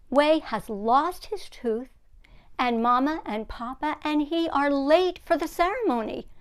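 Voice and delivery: engaging read-aloud questions; teacher asking class. Shown as a demonstration. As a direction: ask the kids to predict.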